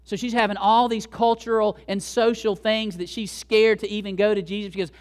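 normal talking voice

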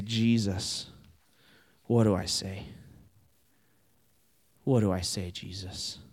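low, hushed plea with a long pause; helpless prayer. Retold or imitated. Youth preacher recalling asking Jesus for words, hushed and helpless. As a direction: quietly ask for help, voice dropping.

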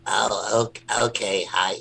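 shy little puppet saying hi; Asher puppet voice. Shown as a demonstration. Rabbi demos new puppet Asher, soft and hesitant. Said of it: "Asher's voice is".

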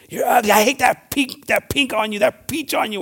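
hammed-up taunting shout; mock yelling insults. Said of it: "just start yelling at him".

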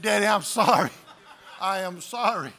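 loud pleading cry of sorry; pleading apology. Retold or imitated. Son pleading with his father, loud apology. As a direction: beg him to stop.

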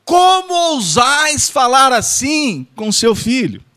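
theatrical shouted reproach for laughs; mock outraged shout. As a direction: bellow like an offended stage monarch. Mock archaic rebuke, repeated.

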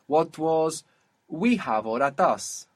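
ordinary delivery

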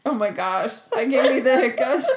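giggling through hiccups; can't stop laughing. Real, not acted. Hiccup fit, both laughing.

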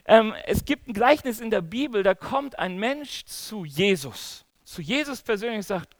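normal talking voice

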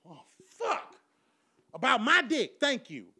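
high, loud outburst at uncle; exasperated protest. Acted. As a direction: blow up, voice jumping up. Acts out exasperated argument with uncle.

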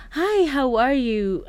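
slow, high, put-on charmer's greeting; imitating the guy. Retelling how the handsome stranger greeted her, slow and high. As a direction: draw it out like a smooth stranger. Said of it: "he was like".

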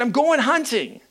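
high-pitched defiant reply; defiant retort. Retold or imitated. Husband to crying wife at the door, acted defiance. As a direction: answer back with pitch pushed up.

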